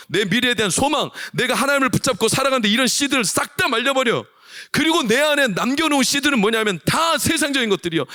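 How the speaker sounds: high-pitched, impassioned preaching; full-force preaching. Real, not acted. Preacher warning the congregation about stolen seed, full-force preaching.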